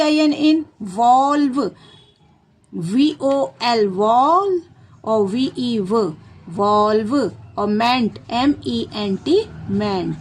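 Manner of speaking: letters of इन्वॉल्वमेंट spelt slowly, chunk by chunk; slow spelling out. Shown as a demonstration. Teacher spells involvement for children, slowly. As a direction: dictate slowly, piece by piece. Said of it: "इनकी spelling तो बच्चों को पता होती है".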